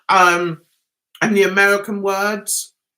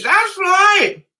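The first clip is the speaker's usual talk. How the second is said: higher, louder "right" called out; loud agreement; 7 semitones higher, 4 dB louder than usual. Real, not acted. Agreeing with a viewer's comment, called out loudly. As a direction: cheer the chat on.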